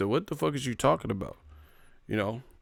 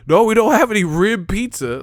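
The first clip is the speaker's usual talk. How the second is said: much higher, louder put-on clerk voice; mock clerk; 11 semitones higher, 9 dB louder than usual. Streamer mocking a pizza clerk's refusal, much higher, louder voice.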